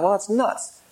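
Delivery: a light scoff at your own stubbornness; mock realization to himself; self-mocking thought. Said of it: "I thought".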